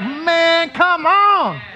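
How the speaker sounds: high, delighted groan of joy; thrilled by the children's singing; delighted exclamation. Retold or imitated. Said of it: "and I'm like".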